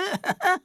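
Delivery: short high nervous giggle; a jittery little laugh. Acted. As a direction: laugh it off anxiously. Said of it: "Er lachte nervös".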